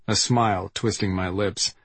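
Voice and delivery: plain reading voice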